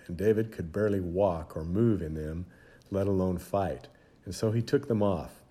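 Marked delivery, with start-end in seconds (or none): none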